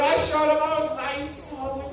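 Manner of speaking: ordinary talk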